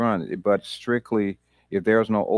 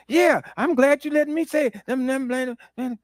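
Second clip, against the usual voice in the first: grateful guy. Retold or imitated. The guy answering, quoted.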